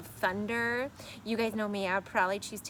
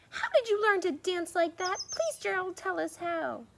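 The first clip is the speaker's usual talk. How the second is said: eager pleading; 10 semitones higher, 4 dB louder than usual. Acted. Animals begging Gerald.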